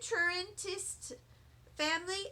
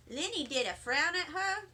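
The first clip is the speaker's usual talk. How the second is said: ordinary delivery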